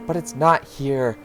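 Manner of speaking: slow, firm mother voice; exasperated grown-up explaining slowly; Rosa. Acted. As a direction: insist to a child, stressing each word. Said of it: "Oh, wait, it's this Rosa".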